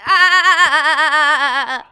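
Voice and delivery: a laughing mock scream; mock startled yelp, laughing. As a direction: blurt out a comic shriek through a laugh. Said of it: "to me it came like".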